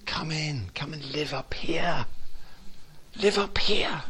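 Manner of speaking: soft, whispered invitation; gentle wooing. Acted. God wooing us into a spacious place, soft whisper. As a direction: coax them in tenderly. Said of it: "He's been wooing, saying".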